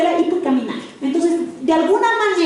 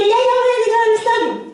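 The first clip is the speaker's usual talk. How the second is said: higher, chirpy mock answer; mock eager reply; 8 semitones higher than usual. Preacher voicing a listener who happily agrees, mock eager reply. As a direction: play the eager student agreeing.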